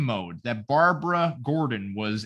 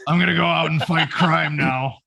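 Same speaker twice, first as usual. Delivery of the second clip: put-on boy Batman; 6 dB louder than usual. Bruce announcing he is Batman.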